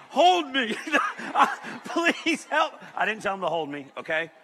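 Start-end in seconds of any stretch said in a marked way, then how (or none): 0.1-2.7 high, hammed-up begging; mock desperate plea. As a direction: plead for help, half laughing. Acts begging the stranger.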